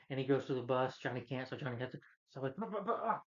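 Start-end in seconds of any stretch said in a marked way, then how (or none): 2.6-3.2 high-pitched string of imitated impact noises; mock crash cries. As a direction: yelp out a silly stumbling "bah, bah, ah". Imitates Johnny's crash through bus.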